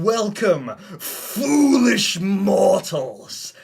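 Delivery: slow, booming villain voice; dark lord. Pretend. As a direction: drag it out like a haunted-house host. Spooky birthday stream welcome.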